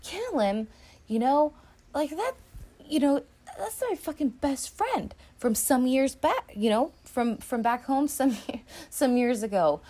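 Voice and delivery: a hint of an outraged gangster; imitating Nicky. Nicky refusing Ginger's request, mock indignant. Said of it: "And he's like".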